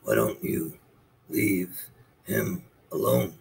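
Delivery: a deep, quiet turtle advising. Acted. Turtle speaks.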